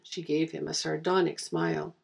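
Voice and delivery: ordinary delivery, nothing marked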